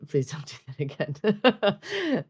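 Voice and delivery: slow auntie voice. Shown as a demonstration. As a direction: scold slowly like an auntie. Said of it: "My auntie voice".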